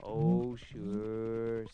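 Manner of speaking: low, drawn-out oh sure; the whiskey. Acted. Whiskey answering the narrator, low and lazy. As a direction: sink the voice down for the whiskey. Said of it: "said the whiskey".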